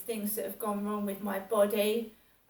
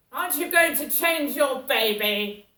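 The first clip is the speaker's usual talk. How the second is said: louder, higher scolding question; 4 semitones higher, 10 dB louder than usual. Retold or imitated. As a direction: hurl the accusation again. Repeats the woman's scolding.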